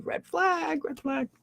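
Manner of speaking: high, drawn-out sing-song warning; mock red-flag alarm. Speaker playfully warns about a chronic relationship pattern, mock alarm. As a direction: sound a soft high-pitched siren on one held note.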